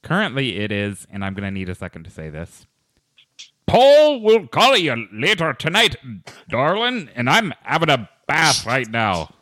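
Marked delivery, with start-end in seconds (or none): 3.7-9.3 high, slow Scottish-Texan lilt with a drawn-out darling; a muddled Scottish and Texas accent, hammed up; put-on Scottish-Texas accent; Scottish-Texas mix accent. Shown as a demonstration. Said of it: "with a mixture of Scottish and Texas accents".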